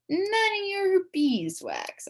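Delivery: high, slow taunt; bratty schoolyard snub; snotty brush-off. Acted. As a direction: drag it out to annoy her. Kid snubbing a question.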